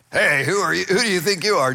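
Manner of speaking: cocky, confrontational demand; brash challenge. Shown as a demonstration. Saul demands to know who dares stop him, brash challenge. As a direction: square up and challenge the stranger. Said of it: "how would you speak in that?".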